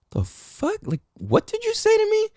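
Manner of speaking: high squeaky outraged what; hearing a mentor question his manhood, affronted; shocked disbelief. Retold or imitated. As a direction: voice shoots way up in disbelief. Shocked inner reaction retold.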